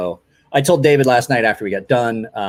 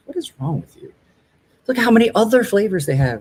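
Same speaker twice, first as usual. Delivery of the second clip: higher, exasperated wife voice; mock wife; 10 semitones higher than usual. Husband imitating his wife's disbelief at his pistachio habit, higher and exasperated. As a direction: play the baffled wife scolding his flavor choice.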